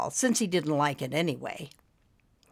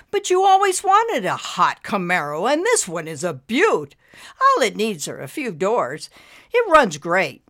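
lightly voiced eager salesman, louder; eager pitch; 5 dB louder than usual. A narrator's reading. Husband sells wife on a Camaro, eager. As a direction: talk it up with punch. Husband pleads for his new car.

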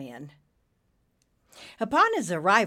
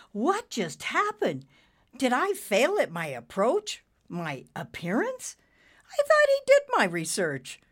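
baffled; 6 semitones higher than usual. A narrator's reading. Character baffled after being attacked.

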